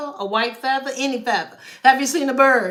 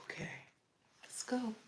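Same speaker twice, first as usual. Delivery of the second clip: usual speaking voice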